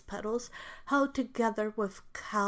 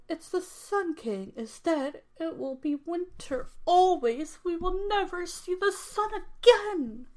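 high, louder, rising despair; distressed fairy; 8 semitones higher, 4 dB louder than usual. Acted. Fairy crying to the gnome that winter stays, high and loud. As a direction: wail it out, climbing higher. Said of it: "And frown".

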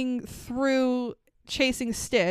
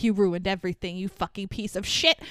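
ordinary talk, nothing marked

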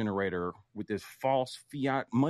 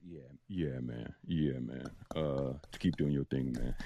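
deep man voice; 10 semitones lower, 4 dB quieter than usual. Acted. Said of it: "Sorry for the performance".

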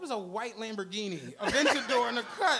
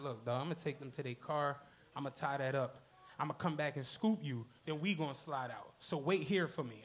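low, laid-back impression of the smooth guy; lowered voice, smooth talker; mock cool guy voice; 11 semitones lower, 7 dB quieter than usual. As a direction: drop it down low and play it cool. Imitating the guy's smooth deep voice.

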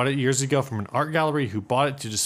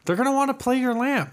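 much higher goofy echo; silly high voice; 13 semitones higher than usual. Pretend. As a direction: parrot it back in a silly high voice. Echoes partner in silly voice.

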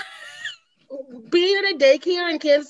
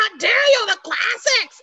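mock outraged yelling; 5 semitones higher, slower than usual. Podcaster mimics people clinging to the canon, mock outraged. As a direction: play up the scandalized purist. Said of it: "there's crying and there's yelling".

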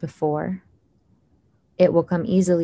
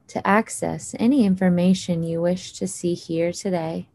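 ordinary delivery

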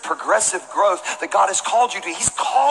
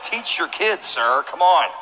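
ordinary delivery

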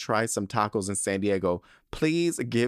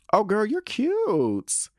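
higher, slow, drawn-out gush; 11 semitones higher, 4 dB louder, much slower than usual. Real, not acted. Host swooning over the listener's boyfriend photo.

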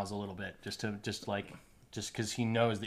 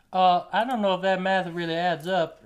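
higher, louder squeaky gnome voice; a silly doubtful little gnome, pitched up; Blumpkin the gnome; 9 semitones higher, 10 dB louder than usual. Acted. Blumpkin the gnome doubting the math, squeaky and high. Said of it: "Blumpkin says".

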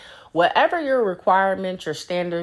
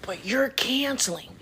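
whisper; 5 semitones higher, 5 dB quieter than usual. Real, not acted. Venting about a cancelled hookup.

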